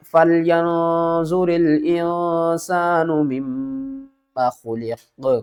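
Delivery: slow melodic tajweed chant; slow measured Quran recitation; Quranic recitation. Shown as a demonstration. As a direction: recite it slowly, drawing out vowels. Teacher recites Quran verse.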